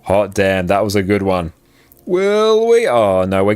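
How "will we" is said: much higher, eager question; hopeful squeak of suspense; tense hope. Real, not acted. Streamer waiting to see if the jump lands, eager.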